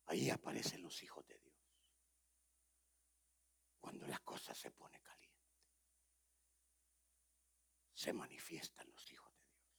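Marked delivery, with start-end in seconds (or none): 3.8-9.6 breathy preacher's whisper. Acted. Preacher whispering to the congregation to fix their homes. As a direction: whisper it like a secret warning.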